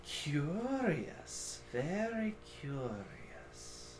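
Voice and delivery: slow, soft, musing; hushed intrigued creature; ancient creature voice. Acted. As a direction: draw out the words quietly.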